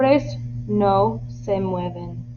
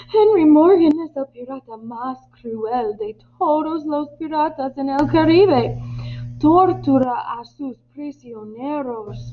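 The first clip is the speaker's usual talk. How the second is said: raised, higher-pitched outcry; alarmed exclamation; 6 semitones higher than usual. Acted. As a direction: exclaim it as a crewman warning the others. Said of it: "Un hombre exclama". (exclamación de alarma, en tono agudo)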